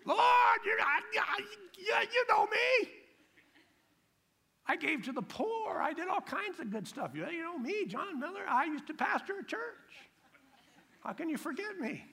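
a frantic man insisting God knows him; mock panicked pleading. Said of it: "pleading man at heaven".

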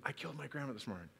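soft, quick secret aside; retold whisper. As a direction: slip it quickly into his ear, imitating. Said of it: "he'd whisper in their ears".

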